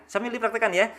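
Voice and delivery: ordinary talk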